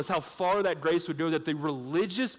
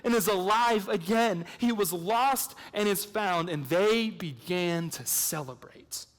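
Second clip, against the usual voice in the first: raised, slowing preacher's read of the parable; ringing sermon reading; preaching; 4 semitones higher, slower than usual. Acted. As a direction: proclaim the father's joy, then slow down.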